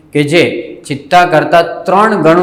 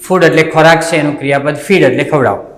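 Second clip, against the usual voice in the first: ordinary talk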